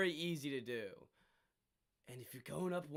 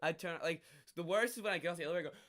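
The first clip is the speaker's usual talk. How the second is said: usual speaking voice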